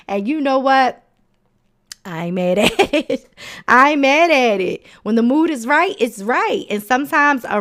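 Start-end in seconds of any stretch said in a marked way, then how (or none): 2.0-3.3 laughing through the words; giggling as she says it. Real, not acted. Host laughing at her own frog joke, giggly. 3.7-4.8 louder, higher, punching mad; emphatic and louder. Real, not acted. Host repeating her frog joke to listeners, louder and higher.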